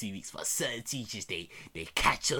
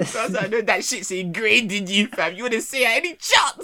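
teasing excitedly; 10 semitones higher, 9 dB louder than usual. Real, not acted. Teasing friend, loud.

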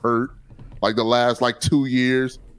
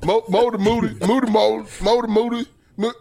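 playful singsong; 11 semitones higher, faster than usual. Acted. Playful chant of nickname.

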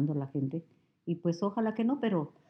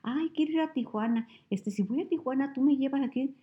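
higher pitched, whiny pleading friend voice; imitating her friend; 8 semitones higher than usual. Friend asking her for a ride to Tijuana, high and pleading. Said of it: "o que dicen".

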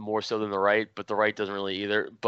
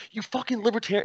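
mock angry yell; 12 semitones higher than usual. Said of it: "his anger went from zero to a hundred".

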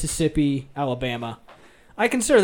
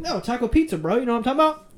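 higher-pitched, urging a puzzled buddy; insistent retold plea; 8 semitones higher, faster than usual. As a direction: re-enact trying to make them understand. Retells pleading with puzzled listeners.

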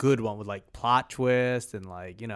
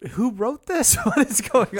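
incredulous; 8 semitones higher than usual. Real, not acted. Baffled reaction laughing.

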